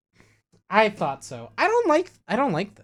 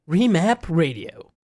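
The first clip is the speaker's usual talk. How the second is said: sultry voice; 4 dB louder, slower than usual. Pretend. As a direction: purr it out like a perfume ad. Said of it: "remap in a sultry voice".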